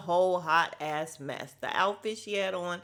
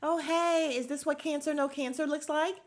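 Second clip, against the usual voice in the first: higher, snide faux-sweet voice; higher mock sarcastic greeting; mock-catty greeting; 7 semitones higher than usual. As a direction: play the catty one sneering sweetly. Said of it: "she's like".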